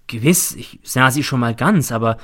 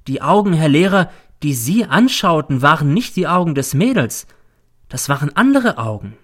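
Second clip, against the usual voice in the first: solemn and emphatic, a little higher; strangely solemn; 5 semitones higher, 5 dB louder than usual. A narrator's reading. Pupil tells teacher about the eyes, strangely solemn. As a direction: make a grave pronouncement, each phrase weighted. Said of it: "plötzlich wird er sonderbar feierlich".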